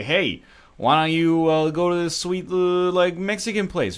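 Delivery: lightly mocking an eager friend; acted invitation. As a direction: pitch it up like a pal proposing dinner plans. Acts out an invitation in LA.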